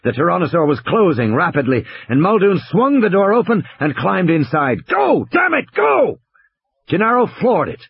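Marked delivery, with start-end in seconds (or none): 4.9-6.2 high-pitched, urgent cursing yell; frantic shouting. A narrator's reading. Muldoon climbs into the jeep yelling at Gennaro, frantic. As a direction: urge the driver on in a high, frantic voice.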